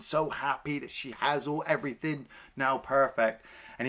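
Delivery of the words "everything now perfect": usual speaking voice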